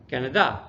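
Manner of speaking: Canada said wrongly as ca-na-DA, stressed at the end; stress on last syllable. Shown as a demonstration. Teacher showing a wrong emphasis, Canada stressed on the last syllable. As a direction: throw the weight onto the final syllable of Canada. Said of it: "if I move it to the end".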